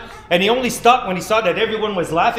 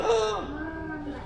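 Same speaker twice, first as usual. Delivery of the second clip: high soft gasp of shock; 10 semitones higher, 8 dB quieter than usual. Acted. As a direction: gasp at the sight. Sees flat tail.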